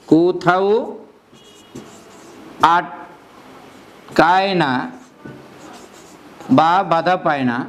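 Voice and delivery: word by word with long pauses; slow dictation. Shown as a demonstration. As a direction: dictate so they can copy. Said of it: "teacher dictating definition". (থেমে থেমে শ্রুতিলিখন)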